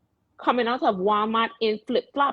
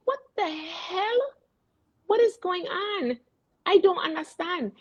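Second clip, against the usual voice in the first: mock-bewildered, voice pitched way up; baffled outburst; 12 semitones higher than usual. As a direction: act out total bewilderment at the top of your range. Acting out her baffled reaction to weather.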